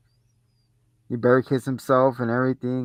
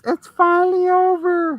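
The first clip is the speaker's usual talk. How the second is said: very high, strained, put-on voice; cartoonish high relief line; mock relief; 5 dB louder than usual. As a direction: squeak it out in hammed-up relief.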